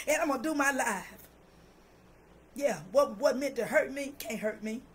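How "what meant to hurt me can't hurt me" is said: ordinary talk, nothing marked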